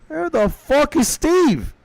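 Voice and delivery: high, drawn-out cry of frustration; exasperated outburst. Real, not acted. Streamer failing to find a game character, in high, slow exasperation.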